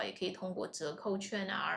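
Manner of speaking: ordinary talk, nothing marked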